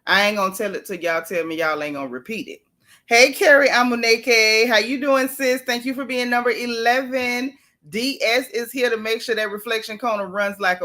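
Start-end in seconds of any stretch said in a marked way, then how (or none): none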